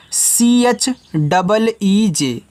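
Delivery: slow, deliberate letter-by-letter spelling; letters spelled out slowly. Shown as a demonstration. Teacher spelling an English word for children, slowly. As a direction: spell each letter apart for the class. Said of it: "teacher spells word letter by letter".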